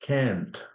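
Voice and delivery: "Can't" with an American front a, as in cat; soft demo of American "Can't". Shown as a demonstration. Teacher showing students how Americans say it, American "Can't". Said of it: "if you're an American".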